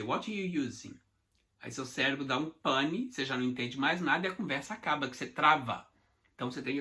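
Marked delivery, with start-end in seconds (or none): 0.0-0.9 quick, words run together; native speed. Shown as a demonstration. Teacher imitating a native speaker, quick and linked. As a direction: rattle it off like a native. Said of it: "Quando o nativo vem e fala".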